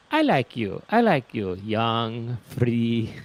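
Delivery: lightly imitated Italian accent, friendly; Italian seller. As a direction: play the flattering street seller with a touch of Italian.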